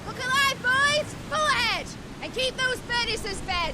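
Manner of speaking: high, loud captain's command over the engines; rally the crew to full speed; shouted orders. Acted.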